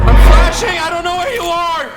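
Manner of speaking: high, panicked yell; frantic shouting. Real, not acted. Player flashing without knowing where it is, panicked shout.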